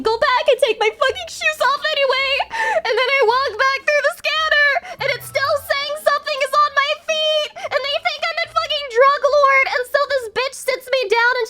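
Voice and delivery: real exasperated laugh while telling it; laughing in disbelief. Real, not acted. Laughing exasperated airport story.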